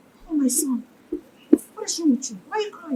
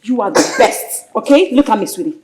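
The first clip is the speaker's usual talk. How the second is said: tender reassurance; 7 semitones lower than usual. Acted. Mother comforts crying daughter.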